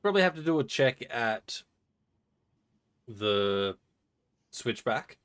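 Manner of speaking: words muffled by ice cube; ice in mouth. Real, not acted. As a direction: talk with cold ice in your mouth. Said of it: "I put ice in my mouth".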